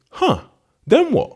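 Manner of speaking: very high, questioning lift; puzzled fisherman. Retold or imitated. Fisherman prompting the businessman for the next step, puzzled. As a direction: pitch it right up as an innocent query.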